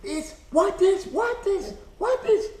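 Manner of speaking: very high, insistent Chinese-accented imitation; frustrated mock Chinese accent. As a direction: mimic a Chinese lady growing frustrated. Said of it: "she's getting more and more frustrated".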